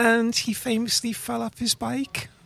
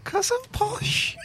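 high, slow laughing posh voice; mock posh; 10 semitones higher, slower than usual. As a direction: camp up the posh act. Joking about being posh.

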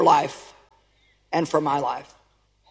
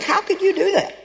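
much higher, pained cry of how could you; anguished reproach; 8 semitones higher than usual. Retold or imitated. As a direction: plead with someone who left. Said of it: "It's like".

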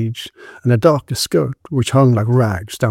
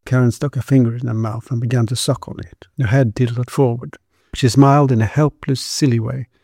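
usual speaking voice

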